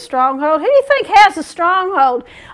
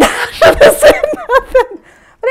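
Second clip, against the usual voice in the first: high, loud voice through laughter; laughing; 10 semitones higher, 5 dB louder than usual. Retold or imitated. Preacher joking about the scared bread guy, laughing. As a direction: act out regret while laughing.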